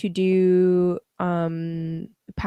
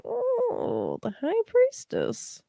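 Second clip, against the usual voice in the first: surprised, much higher; high excited voice; 4 dB quieter, much slower than usual. Real, not acted. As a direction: squeal the card name. Reads tarot card.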